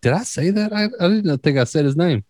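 realising he let the name slip, high and surprised; mock disbelief. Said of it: "I was like".

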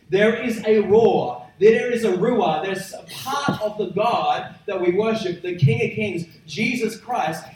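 rising sermon. Acted. Pastor building up to the congregation about God's roar, rising preaching. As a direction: climb to full force for the congregation.

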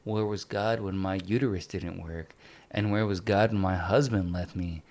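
low, soft, mildly downcast quote; mock jaded. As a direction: voice a weary character, low and understated. Voices jaded character in restaurant.

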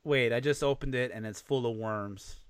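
ordinary talk

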